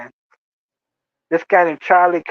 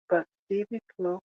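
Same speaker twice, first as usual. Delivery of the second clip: soft, wounded lover's coo; mock-lovelorn plea; 8 dB quieter than usual. [name] begs his coworker not to deny love, mock-tender plea. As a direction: plead softly, as if spurned in love.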